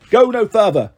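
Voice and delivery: self-important goat sentry; big, important voice. A narrator's reading. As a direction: order them to halt with pomp. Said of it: "in a very big, important voice".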